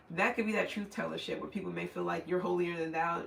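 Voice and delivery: ordinary talk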